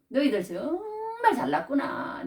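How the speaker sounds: slow, high sneering praise; mock the proud church with fake praise; sarcastic mockery. Paul mocking the Corinthians, slow high sarcastic praise. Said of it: "괜히 비꼬듯이 그래요".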